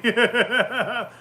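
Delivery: big high burst of laughter; loud laugh. Real, not acted. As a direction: cackle at the memory. Laughing at own story.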